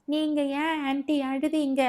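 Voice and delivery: put-on high-pitched little child's question; innocent child. A narrator's reading. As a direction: ask like a guileless small kid. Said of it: "கள்ளம் கபடம் அறியாத பிஞ்சு மனது".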